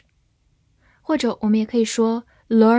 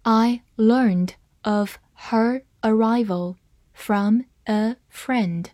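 very slow, word by word, with gaps between words; slow demo reading; slower than usual. Shown as a demonstration. Teacher modelling the sentence for learners, slow word-by-word reading. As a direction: read it out slowly for learners, each word separate. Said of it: "好，慢慢来读".

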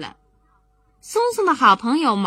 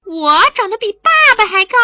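put-on much higher and louder kid boast; excited child; 10 semitones higher, 9 dB louder than usual. Maomao on tiptoe boasting to Songsong, high excited child. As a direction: shout it up on your tiptoes.

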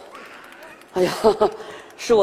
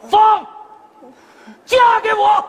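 shouted, higher marriage proposal; loud proposal; 7 semitones higher, 3 dB louder than usual. Acted. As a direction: shout it out bravely to her. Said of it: "大声地对你说". (鼓起勇气大声喊出求婚)

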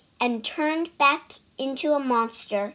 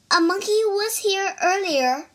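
cartoon princess; 8 semitones higher than usual. Princess answers the monster.

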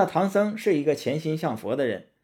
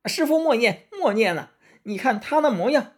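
very high, frantic pleading shout; urgent cry; 12 semitones higher, 4 dB louder than usual. A narrator's reading. Sun Wukong begs master not to chant, urgent high shout. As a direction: cry out fast to stop your master. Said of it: "孙悟空急忙叫道".

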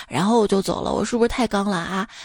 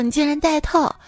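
mild surprise, pitched higher; a touch of higher-pitched disbelief; surprised girlfriend; 5 semitones higher than usual. Acted. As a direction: lightly act an astonished girlfriend. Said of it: "女友惊讶说".

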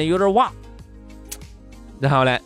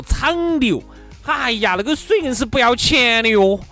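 griping mother-in-law; 7 semitones higher, slower than usual. Acted.

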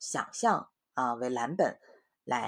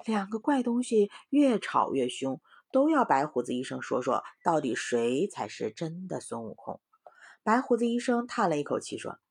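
story reading; 6 semitones higher, 5 dB louder than usual. A narrator's reading. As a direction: lively voice reading to children. Mother reads story to children. (给孩子讲故事的生动语气)

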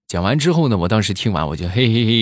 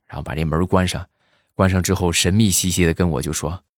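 usual speaking voice

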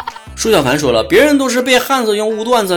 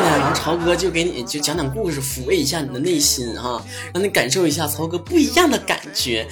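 soft, low seductive voice; sexy voice; 3 semitones lower, 6 dB quieter than usual. Shown as a demonstration. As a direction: drop into a late-night seductive purr. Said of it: "非常sexy的声音".

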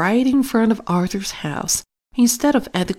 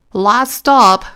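a louder call down the bus; 4 semitones higher, 7 dB louder than usual. A narrator's reading. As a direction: call it out to the passengers. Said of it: "called the driver".

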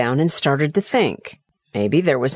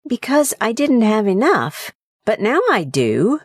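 put-on higher, simple childlike answer; young son's voice; 7 semitones higher than usual. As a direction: answer plainly like a small boy. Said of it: "the little boy replied".

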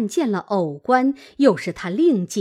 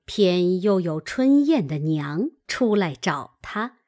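plain reading voice

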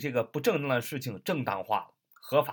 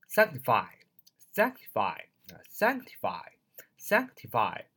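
sanctify 开头 SANC 重读，慢速示范; 慢慢读 sanctify，重音放在最前面的 sanc 上; 首音节重读; slower than usual. Shown as a demonstration. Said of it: "重音在最前面啊". (sanctify 词首重音，放慢领读)

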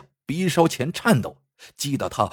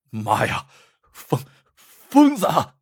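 shouting in terror; 2 semitones higher than usual. Acted. Terrified bandit screams at the self-cutting Lu Dui. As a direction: scream it out as you flee. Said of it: "他大喊".

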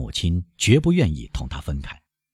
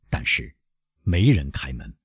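usual speaking voice

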